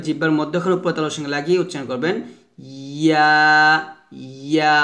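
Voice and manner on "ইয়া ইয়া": ইয়া with a light glide held into aa; light drawn YA. Shown as a demonstration. Teacher shows the normal light way, drawn ইয়া. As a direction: say ইয়া lightly but let it lengthen. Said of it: "হালকা নর্মালভাবে উচ্চারণ করবেন".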